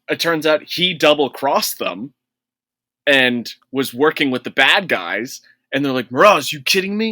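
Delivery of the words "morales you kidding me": higher, quick betrayed disbelief; mock shock. The squad learns their teammate betrayed them, quick mock shock. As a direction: blurt out the name in betrayed shock.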